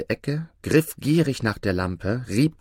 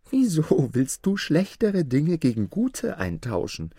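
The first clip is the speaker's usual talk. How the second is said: high-pitched, puzzled princess voice; laughing-at-him princess; 8 semitones higher than usual. A narrator's reading. Princess questioning the odd trader at her window, amused.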